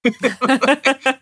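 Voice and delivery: laugh. Real, not acted. Laughs at her own joke.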